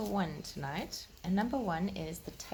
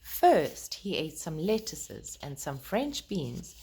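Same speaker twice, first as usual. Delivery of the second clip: put-on gentle, lilting read-aloud to children; storybook reading. Reading Peter Rabbit aloud to the children, gentle storytelling voice.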